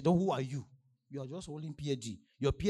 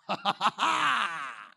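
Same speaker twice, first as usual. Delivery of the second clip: loud rising laugh that falls away; 6 semitones higher, 9 dB louder than usual. Real, not acted. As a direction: burst out laughing, long and loud. Speaker's own laugh while preaching.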